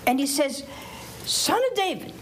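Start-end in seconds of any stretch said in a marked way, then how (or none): 1.2-2.1 mock yell. Blind man calling to Jesus, mild mock yell. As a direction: call out for help, lightly acted. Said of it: "he was yelling and screaming".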